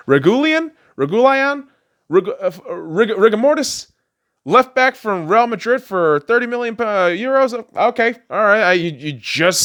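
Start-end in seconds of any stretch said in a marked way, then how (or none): 0.1-3.9 high, loud disbelieving repeat of the name; baffled he can't say the new signing's name, incredulous. Real, not acted. Mocking mispronounced new signing name.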